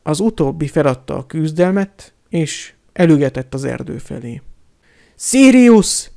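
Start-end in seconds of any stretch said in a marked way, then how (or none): 5.2-6.1 much higher, loud call of Sirius; shouted name. A narrator's reading. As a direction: call out across the dark. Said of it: "kiáltotta Harry".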